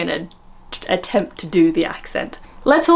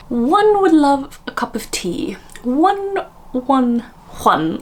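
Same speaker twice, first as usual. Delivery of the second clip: hyper RP, high and drawn out; exaggerated posh royal English; the Queen's accent; 7 semitones higher, slower than usual. Shown as a demonstration. Vlogger imitating the Queen asking for tea, hyper RP accent. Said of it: "hyper R P accent".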